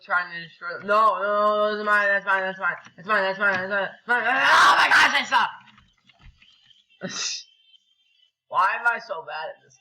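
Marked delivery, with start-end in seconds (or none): 0.9-4.2 loud, insistent run of repeats; frantic protest. Real, not acted. Gamer protesting that loot is his, frantic. 4.3-5.5 high, loud outburst of frustration; real exasperated shout. Real, not acted. Gamer raging at himself for sucking, exasperated shout. Exasperated after losing loot.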